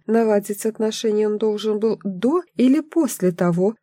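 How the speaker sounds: usual speaking voice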